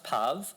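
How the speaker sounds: "puv" with the UH of "shove"; rhymed with shove. Shown as a demonstration. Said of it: "is it shove".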